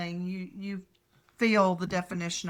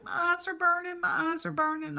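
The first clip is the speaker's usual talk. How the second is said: higher child voice, a mild mock wail; retold child's cry; 9 semitones higher than usual. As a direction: imitate a little girl with stinging eyes, half-cried. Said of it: "and she's yelling".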